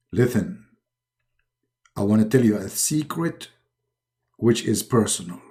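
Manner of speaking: low, measured godly voice; solemn deity, pitched low; Allah. Acted. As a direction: confide in a deep, calm voice. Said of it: "I am Allah".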